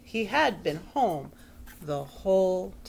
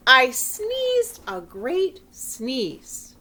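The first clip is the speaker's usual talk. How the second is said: slow; 4 semitones higher, 5 dB louder, much slower than usual. A narrator's reading. Dramatic sneeze build.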